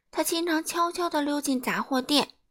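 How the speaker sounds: ordinary delivery, nothing marked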